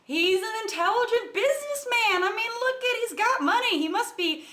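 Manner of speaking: mocking put-on voice, starting high; mindless supporter parody. Commentator mimicking what people mindlessly repeat, mocking supporter voice. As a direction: parrot it like a mindless fan. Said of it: "people are just going to mindlessly repeat".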